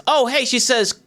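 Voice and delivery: normal talking voice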